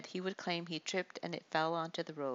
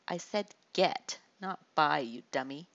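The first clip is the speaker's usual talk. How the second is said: slow, pressing each word, louder; slow and deliberate; 5 dB louder than usual. Acted. Bully leans close to Amiz, slow and deliberate. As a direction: lean in and spell it out slowly. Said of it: "spoke slowly and deliberately".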